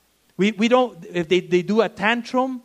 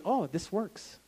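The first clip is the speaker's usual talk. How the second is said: put-on sly child whisper; 3 semitones lower, 8 dB quieter than usual. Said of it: "they'll say".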